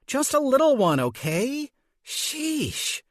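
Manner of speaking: high, scoffing sheesh at the end; exasperated mother. Acted. Mother scoffs at her daughter over the cake money, exasperated. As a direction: brush her off with a huff.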